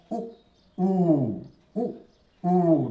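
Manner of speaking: brief उ, long held ऊ; short-long vowel drill. Shown as a demonstration. Teacher models correct pronunciation, short उ then long ऊ. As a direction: cut उ short and sustain ऊ. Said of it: "शुद्ध शुद्ध उच्चारण सिखाएं".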